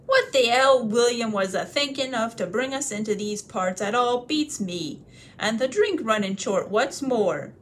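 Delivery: loud, rough troll voice, grumbling; gruff troll grumbling. Acted. Troll grumbling about William to the others, loud and coarse.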